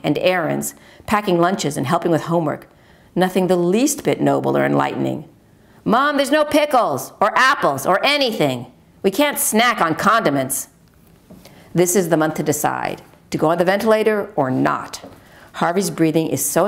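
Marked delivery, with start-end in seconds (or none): 5.8-10.7 put-on higher, boyish whine about the empty fridge; son's complaint. Son complains to mom about snacks, lightly whiny boy voice. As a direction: play the son, griping lightly.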